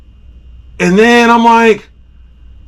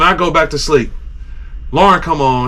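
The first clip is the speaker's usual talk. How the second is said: ordinary talk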